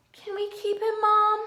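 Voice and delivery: put-on high begging kid voice; little kid asking mom, pleading; pleading child. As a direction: beg mom to keep the dog.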